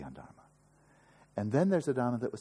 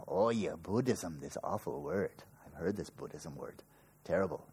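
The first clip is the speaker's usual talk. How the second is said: mock disdain; 5 semitones lower than usual. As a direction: sneer at the word like a grumpy skeptic. Mocks disdain for the word Buddhism.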